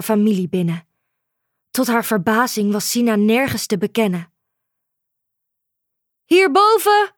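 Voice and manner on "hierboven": loud, high call from the roof. A narrator's reading. Mother calling down to her daughter from above. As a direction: shout down to get attention. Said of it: "riep Sina".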